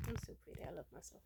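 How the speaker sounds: soft whispered I'm so pretty; whispered self-praise. Real, not acted. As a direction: whisper it to yourself in the mirror.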